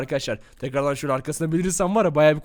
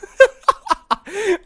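high giggle; 8 dB louder than usual. Acted. Ghost giggle before scare.

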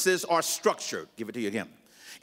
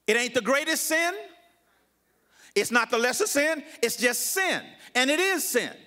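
high, insistent pulpit delivery; raised preaching voice in short, separate blows; emphatic preaching; 8 semitones higher than usual. Real, not acted. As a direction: press each statement home firmly. Preaches the conclusion forcefully.

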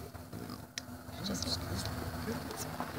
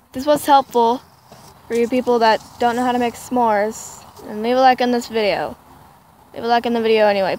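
loud; 8 dB louder than usual. Real, not acted. Loud vlog outro.

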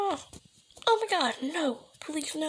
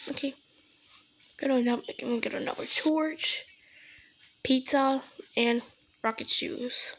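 usual speaking voice

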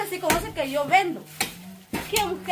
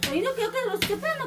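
ordinary talk, nothing marked

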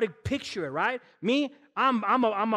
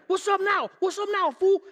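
high, loud street taunt; mock tough challenge; 10 semitones higher, 5 dB louder than usual. Preacher taunting a cornered cat with a broom, mock tough. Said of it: "I'm there like".

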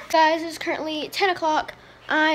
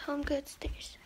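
whisper; 11 dB quieter, slower than usual. Real, not acted. Whispering while out shopping.

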